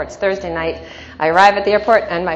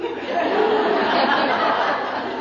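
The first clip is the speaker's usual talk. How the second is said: big laugh; 14 semitones higher, 3 dB quieter than usual. Real, not acted. Laughing at kids' reply.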